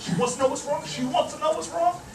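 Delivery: mock-incredulous rising question; indignant echo. Worked up, repeats her request indignantly.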